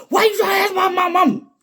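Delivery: high, loud, fast defensive yell; hammed-up angry shout at her; mock outraged shout. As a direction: shout it back like the offended man. Man shouting at mother question.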